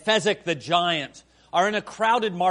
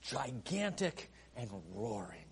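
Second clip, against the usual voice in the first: quiet echo; 8 semitones lower, 17 dB quieter than usual. Shown as a demonstration. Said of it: "repeated softly for effect".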